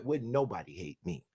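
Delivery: usual speaking voice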